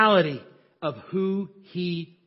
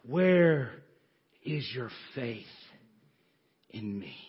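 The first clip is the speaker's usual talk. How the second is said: soft and much lower, almost hushed; gentle rebuke; 8 semitones lower, 6 dB quieter, slower than usual. Acted. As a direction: ask it tenderly, fading away. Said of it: "Jesus turned to his disciples and says".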